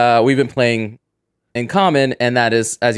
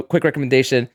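normal talking voice

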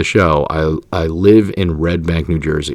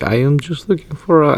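put-on higher, half-hearted stab at a Ghanaian accent; failed Ghanaian accent; 7 semitones higher than usual. Host imitating a woman's scam message, botched Ghanaian accent. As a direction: try a Ghanaian accent and give up on it. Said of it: "I can't do a Ghanese accent".

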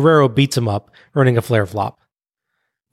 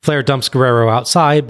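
usual speaking voice

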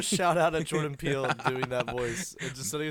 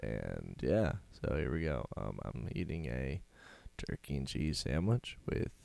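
ASMR voice; 8 semitones lower, 4 dB louder than usual. Pretend. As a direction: hush it like a mock ASMR video. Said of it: "I was doing A S M R".

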